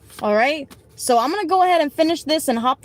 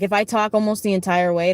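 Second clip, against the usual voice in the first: ordinary talk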